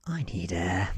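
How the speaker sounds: ordinary delivery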